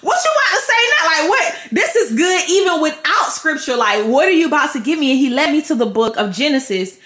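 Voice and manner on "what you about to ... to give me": higher-pitched eager amazement; giddy, high-voiced astonishment; excited disbelief. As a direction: react like you can't wait for what's next. Acting excited reaction during quiet time.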